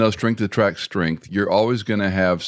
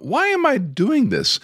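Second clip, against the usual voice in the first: much higher, slow, fed-up question; exasperated; slower than usual. Retold or imitated. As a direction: drag it out in disbelief.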